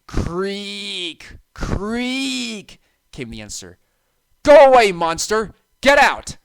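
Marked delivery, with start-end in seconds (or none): 0.1-2.8 creaking noise. A narrator's reading. Answer from the dark. 4.4-6.4 loud, high shout; frightened order. A narrator's reading. Thomas shouting at the monster in the dark, frightened shout. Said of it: "Thomas ordered".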